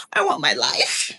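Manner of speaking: low, slow, hammed-up tearful plea; mock tearful. Preacher as Esther begging the king for her life, mock tearful. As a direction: fake being on the edge of tears. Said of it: "Cue tears".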